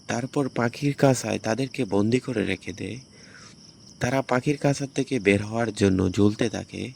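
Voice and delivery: usual speaking voice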